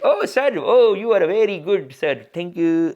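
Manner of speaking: put-on Indian accent, gushing at the professor; deferential Indian student. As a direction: fawn over sir in an Indian student's voice. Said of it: "If a professor says something, we're just like".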